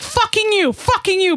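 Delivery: high-pitched mock cursing in mom's broken English; imitating mom's broken English. Daughter imitating mom cursing at a guy, high broken English. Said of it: "she'll be like".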